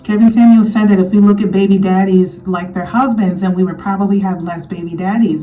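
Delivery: usual speaking voice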